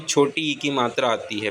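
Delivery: ordinary delivery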